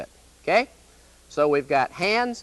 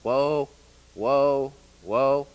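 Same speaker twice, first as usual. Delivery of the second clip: repeated whoa; much slower than usual. Retold or imitated. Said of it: "and so they say".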